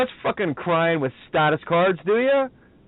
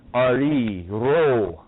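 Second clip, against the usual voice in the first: ordinary delivery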